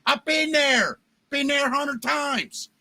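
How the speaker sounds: a radio caller's Baltimore accent, hammed up and shouted; mock Baltimore accent. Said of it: "I'm doing this accent".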